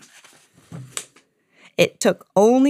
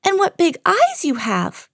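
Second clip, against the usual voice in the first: put-on much higher wondering girl; wide-eyed girl; 10 semitones higher than usual. A narrator's reading. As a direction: marvel at it, high. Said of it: "said Little Red Riding Minnie".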